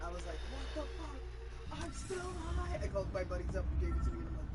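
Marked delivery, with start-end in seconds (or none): 0.5-2.8 high, slow disbelief; freaked-out stoner realising he is still high. Retold or imitated. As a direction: drag it out in alarm. Retold panic, still high.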